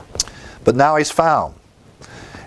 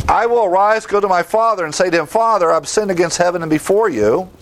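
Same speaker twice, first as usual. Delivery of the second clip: higher, pleading son's confession; a humble son begging his father, pitched up; lightly voiced repentant son; 7 semitones higher than usual. Pastor voicing the prodigal son confessing, lightly pleading.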